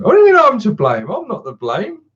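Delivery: mock-offended outburst at the chat; mock outrage. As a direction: play wounded innocence, over the top. Reacting to chat blame.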